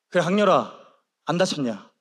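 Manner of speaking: low, softening fatherly check-in; father's concern. Retold or imitated. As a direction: voice a caring dad, pitched well down. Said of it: "아버지가 한 말씀 하세요".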